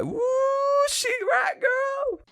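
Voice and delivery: high shrieking cheer; cheering shout. Retold or imitated. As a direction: yell it like a hyped fan. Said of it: "Greta's with Karen going like".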